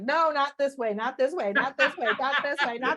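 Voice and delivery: a fed-up guide scolding; mock exasperated cosmic voice; exasperated universe. Universe voiced telling not this way.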